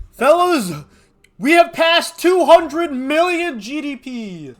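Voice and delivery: loud, high, drawn-out hype; excited shout. Real, not acted. Gamer hyping his boys over GDP, loud triumphant shout.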